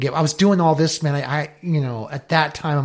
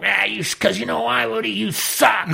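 higher mock taunt; imitating Hobie teasing; 8 semitones higher than usual. Hobie mocking Woody for catching nothing, high teasing mock voice. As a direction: rib him like a rough old mentor. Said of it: "and he'd be like".

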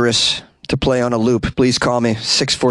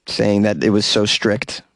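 normal talking voice

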